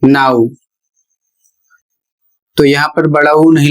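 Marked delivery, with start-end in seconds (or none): 0.0-0.5 नाउ said as "now", ending on a short U glide; नाउ as a classroom example word; careful English pronunciation. Shown as a demonstration. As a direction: model नाउ with a clear OW glide. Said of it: "इसका उच्चारण हो जाएगा".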